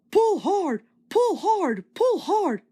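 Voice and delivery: high, rhythmic puffed chant; puffing. A narrator's reading. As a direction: puff each word like pistons. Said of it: "puffed Edward".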